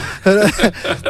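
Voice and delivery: short real chuckle; light laugh, a little high. Real, not acted. Guest to interviewer over a repeated question, chuckling.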